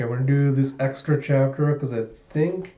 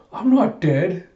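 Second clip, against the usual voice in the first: higher-pitched mock outburst; indignant protest; 6 semitones higher, slower than usual. Damien's indignant retort retold.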